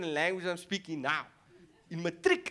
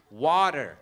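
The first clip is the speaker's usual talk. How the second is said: put-on loud, lower "water" in American accent; 4 semitones lower, 7 dB louder than usual. Comic finally ordering in their accent, American accent. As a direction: give in and say it American. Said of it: "their own accent".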